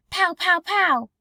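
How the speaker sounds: high, loud, slow pow gun noises; children's pretend gunfire; mock gunfire. Child playing war.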